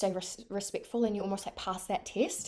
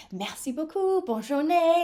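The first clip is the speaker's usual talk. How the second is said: higher, bright sing-song French goodbye; overly sweet thanks; 8 semitones higher, 5 dB louder, slower than usual. Retold or imitated. Leaving the luggage desk, sugary high-pitched thanks. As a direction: gush your thanks with relief. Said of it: "you end the conversation and you're like".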